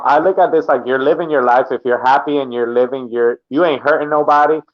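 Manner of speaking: ordinary talk, nothing marked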